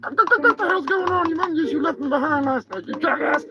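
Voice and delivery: high, squawky put-on turkey voice, annoyed; angry turkey character. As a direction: play an irritated turkey scolding his pal.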